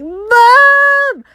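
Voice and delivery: high, loud calling for mom; a scared kid calling mom from the stairs; retold panicked call. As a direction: yell for mom downstairs in a panic. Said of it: "I was just running downstairs like".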